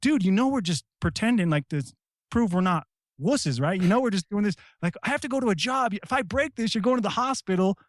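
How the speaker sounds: high, urgent plea; nervous high-pitched bargaining; pleading with opponent. Retold or imitated. Me in an arm bar begging the guy to tap. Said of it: "I'm looking at him like".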